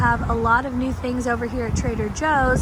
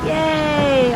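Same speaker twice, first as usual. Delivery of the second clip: excited; 5 semitones higher, 4 dB louder than usual. Real, not acted. Delighted at the spring flowers.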